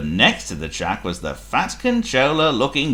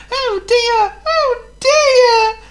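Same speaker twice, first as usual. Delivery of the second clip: very high, loud wail; groaning engine; 7 dB louder, much slower than usual. Acted. Thomas groaning as he skids. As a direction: groan in dismay. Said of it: "Thomas groaned".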